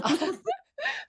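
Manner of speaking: squealing high laugh; high burst of laughter. Real, not acted. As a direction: break into a shrieking laugh. Laughing at the memory.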